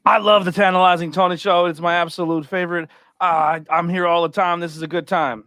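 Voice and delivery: loud, high hyped promo voice; wrestling promo. Shown as a demonstration. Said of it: "I'm gonna cut a promo".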